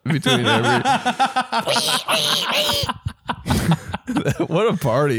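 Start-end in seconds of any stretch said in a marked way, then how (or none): 0.9-4.4 pig squeal. Pretend. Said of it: "Try to make a pig noise".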